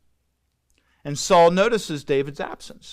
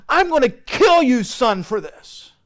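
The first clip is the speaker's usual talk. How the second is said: much higher and louder, a furious threat; enraged king; 8 semitones higher, 5 dB louder than usual. Retold or imitated. Saul threatens Jonathan at the feast, furious yell. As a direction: roar the threat at your son in a rage. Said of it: "But there's a rage".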